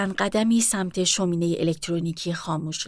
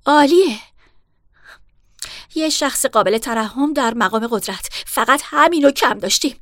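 louder, higher sarcastic outburst; bitter sarcasm; 3 semitones higher, 5 dB louder than usual. Acted. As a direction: spit out the mock praise.

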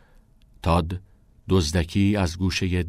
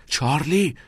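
higher-pitched calling of the name; raised but unshouted exclamation; sharp call; 7 semitones higher, slower than usual. A narrator's reading. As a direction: check a friend by calling his name. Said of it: "ناکس گفت".

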